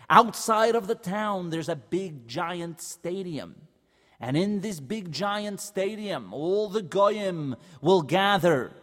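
ordinary delivery, nothing marked

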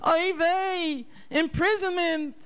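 very high pleading mother's voice; mother begs Nachmanson to take her, high and pleading; begging for mercy. A narrator's reading. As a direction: plead with the interrogator, voice way up. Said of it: "she begged with mercy".

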